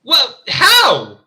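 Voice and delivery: high-pitched, loud disbelief; incredulous protest. Real, not acted. Reader protesting a character's sudden death, incredulous. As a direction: wail it in utter bewilderment.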